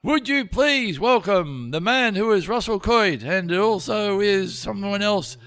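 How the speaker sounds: high, slow grand MC intro; mock announcer. A dad introducing his comedian son to residents, slow and high. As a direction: announce like a compere.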